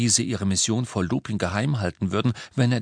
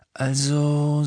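drawn-out, higher held word; slow; 7 semitones higher, 3 dB louder than usual. A narrator's reading. Harry weighing his answer to Lupin, slowly. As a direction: stretch "Also" out deliberately. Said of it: "sagte Harry langsam".

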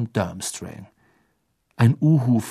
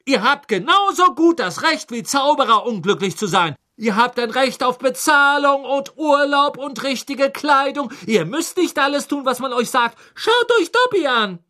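high, louder lecturing voice; rally the elves, fired up; angry; 14 semitones higher, 3 dB louder than usual. A narrator's reading. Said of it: "sagte Hermine wütend".

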